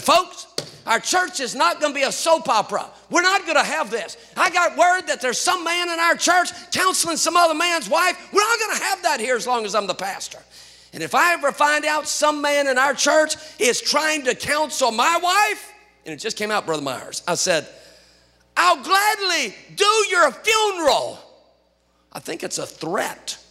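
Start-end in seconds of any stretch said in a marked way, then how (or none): 0.0-15.7 high, loud scolding from the pulpit; stern rebuke. Retold or imitated. Pastor scolding members, loud stern rebuke. 18.6-21.2 high shouted threat, slow; mock threat. Pastor warning the church, mock shouted threat.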